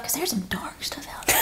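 hushed, breathy, almost voiceless; a mock-scared whisper about the dark books; mock ominous whisper. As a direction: whisper it like a spooky secret. Dark stuff, whispered aside.

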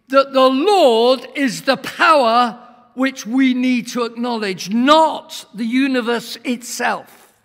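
loud, slow proclamation; fiery preaching. Acted. Preacher urging congregation to acknowledge the Lord, booming. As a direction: hammer the point home.

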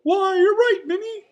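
squeaky falsetto shout; Mickey. A narrator's reading. Mouse agreeing with his friend, high excited shout. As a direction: shout it very high and loud, delighted. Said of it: "Mickey shouted".

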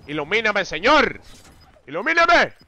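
hammed-up plea to heaven; mock prayer. Mock prayer begging for help. (súplica exagerada y fuerte al cielo)

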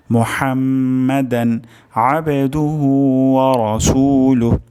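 whole phrase recited slowly, measured, chant-like; slow recitation. Shown as a demonstration. Teacher recites the full phrase again for students, slow chant. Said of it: "আরেকবার উচ্চারণ করছি".

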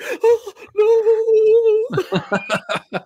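high, drawn-out mock wail of horror; mimicked screaming. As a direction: imitate a screaming girl with a long high "no". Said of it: "she's screaming the whole time".

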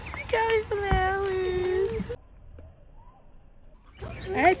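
slow, high voice breaking into tears; crying through the words. Real, not acted. Overwhelmed by a birthday gift.

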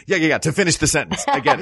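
fast, hurried yeah-yeah-yeah; impatient New Yorker. Retold or imitated. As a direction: rush them along like an impatient New Yorker. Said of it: "the New Yorker was like".

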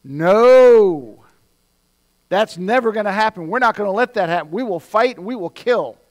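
acting out Peter's loud refusal; blurted defiant outburst. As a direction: burst out in fierce protest. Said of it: "Peter blurts out and says".

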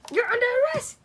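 high, loud play-acted order; police arrest shout. Toy policeman arrests a thief, loud high shout. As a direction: shout it like an excited toy cop.

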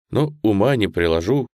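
plain reading voice

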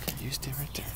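soft unvoiced whisper to a dog. Real, not acted. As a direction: breathe the order under your breath.

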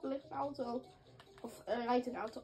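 ordinary talk, nothing marked

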